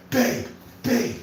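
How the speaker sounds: imitating a customer begging his wife to take his side; whiny appeal to wife.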